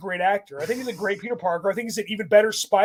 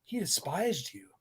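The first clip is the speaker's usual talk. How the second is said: quiet cold put-down; 5 semitones lower, 7 dB quieter than usual. Retold or imitated. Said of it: "And Peter's like".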